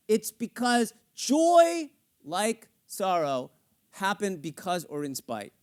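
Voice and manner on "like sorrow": normal talking voice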